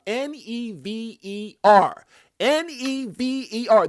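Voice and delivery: each letter of "N E V E R" slow and loud; drawn-out, booming "N E V E R"; emphatic spelling out. Real, not acted. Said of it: "so I'm gonna spell it out".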